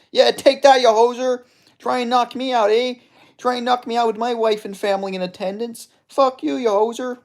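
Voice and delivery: loud, high-pitched Canadian trash talk; Albertan hoser. Acted. Gamer voicing his fighter mid-brawl, high, loud Canadian accent. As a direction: taunt like a hammed-up Canadian hoser. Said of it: "I'm doing that voice for this guy".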